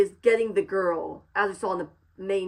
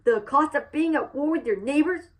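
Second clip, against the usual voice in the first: higher-pitched put-on character voice; earnest impression in a raised pitch; Joe Cross; 7 semitones higher, 3 dB louder than usual. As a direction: do your best movie-character impression, pitched up. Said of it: "doing my best Joe Cross impression".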